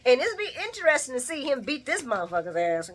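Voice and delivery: normal talking voice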